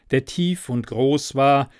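ordinary delivery, nothing marked

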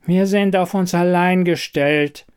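Toaster, höher, resigniert und traurig. A narrator's reading. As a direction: als Gerät, das die bittere Wahrheit ausspricht. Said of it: "sprach der Toaster die traurige Wahrheit aus". (traurige Toasterstimme, höher)

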